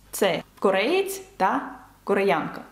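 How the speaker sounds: ordinary talk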